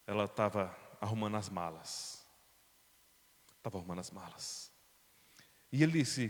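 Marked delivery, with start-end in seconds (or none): 3.6-4.7 much softer, hushed repeat; hushed echo. Shown as a demonstration. Preacher echoing the discovery softly to the congregation, hushed. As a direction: let the words fall away quietly. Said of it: "repeated line".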